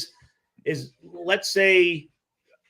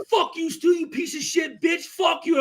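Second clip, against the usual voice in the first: high, mock furious yelled insults; angry cursing; 10 semitones higher than usual. Imitating Vrabel's rage at Stu, high hammed-up yelling. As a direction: spit the curses like a raging rival. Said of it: "He's like".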